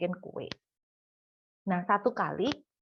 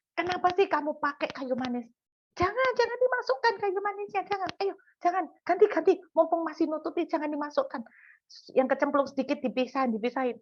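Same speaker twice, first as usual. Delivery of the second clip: high-pitched flustered mum protesting; acted-out urgent pleading, easing toward the end; insistent mock protest; 7 semitones higher than usual. Mother tries to stop child adding cinnamon, insistent nagging. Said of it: "Saya tetap ngotot".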